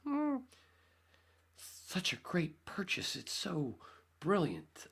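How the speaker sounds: high mock-admiring voice; sarcastic praise. Owner sarcastically praising his broken Jeep, mock-admiring. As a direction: gush it with heavy sarcasm.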